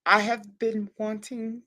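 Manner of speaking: slow, measured restart; deliberately slow and composed; calming down. Real, not acted. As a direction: rein in the excitement, word by word. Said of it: "I have to calm down".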